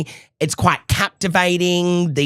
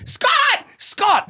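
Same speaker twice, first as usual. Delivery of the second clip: retold scream. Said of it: "This woman screaming".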